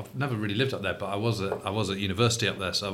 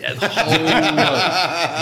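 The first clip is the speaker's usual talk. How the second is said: slow, louder, laughing words; 6 semitones higher, 7 dB louder, much slower than usual. Real, not acted. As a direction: chuckle it out slowly. Joking about flies in beer.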